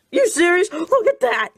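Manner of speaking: can't believe what he sees; incredulous. Real, not acted. Disbelief at the costume.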